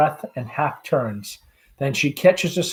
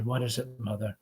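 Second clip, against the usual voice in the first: much softer and lower, tender; gently; 3 semitones lower, 6 dB quieter than usual. Acted. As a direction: ask her gently, quietly. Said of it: "Tom, gently".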